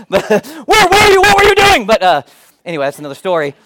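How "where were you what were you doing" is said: high, loud scolding; scolding mom. Retold or imitated. Mother scolding her lost son, loud and high. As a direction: let him have it like a frantic mom. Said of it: "she lays into him".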